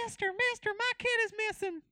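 desperate high-pitched plea; frantic parent in a high voice; high panicked voice. Pretend. Acts out distressed character.

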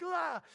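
loud, high exasperated "Ah"; exasperated cry. Retold or imitated. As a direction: let out a frazzled, overwhelmed cry. Confused cry imitated.

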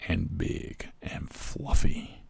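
breathy slow whisper of disbelief. Real, not acted. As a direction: whisper it slowly, savoring. Whispered eyebrow description.

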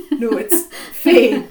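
slow, loud "No, it's Faye" through laughter; drawn-out laughing "Faye"; laughing correction. Retold or imitated. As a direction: insist on "Faye" with a long ay. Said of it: "I'm like".

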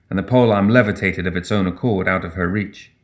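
plain reading voice

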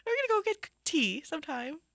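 high, light posh voice; posh rapper. Pretend. Host imitating the rapper as a tea-sipping gent, high. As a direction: play the rapper gone genteel, up high.

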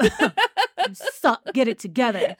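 high-pitched put-on ballet tyrant; lightly hammed-up scolding of a dance class; mock berating teacher. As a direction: imitate a teacher heckling the dancers. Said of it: "he's, like, berating them".

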